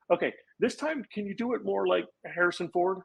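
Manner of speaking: imitated casting director. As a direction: lightly mimic her asking for another take. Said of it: "And then she goes".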